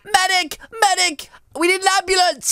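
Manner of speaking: high, urgent mock emergency call; play-acted alarm, pitched high; calling for help. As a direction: cry out for a medic. Calls for medical help.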